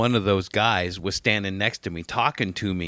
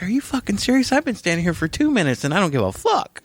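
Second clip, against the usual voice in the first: exasperated rant; 8 semitones higher than usual. Retold or imitated. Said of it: "I'm like".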